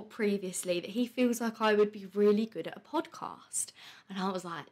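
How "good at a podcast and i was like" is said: a quick breathy aside, under her breath; whisper. Real, not acted. Recalling a friend's podcast idea in a sudden whisper. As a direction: drop to a secret whisper for a moment.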